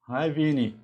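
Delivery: ordinary talk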